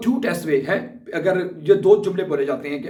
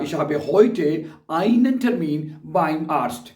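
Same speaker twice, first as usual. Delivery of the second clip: very slow, every German word spelled out for learners; slow dictation; much slower than usual. Shown as a demonstration. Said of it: "या".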